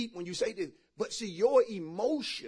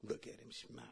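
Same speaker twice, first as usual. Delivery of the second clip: hushed, much softer mocking whisper; whispered aside; 9 semitones lower, 20 dB quieter than usual. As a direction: sneer it under your breath. Mocking aside about ex's photo.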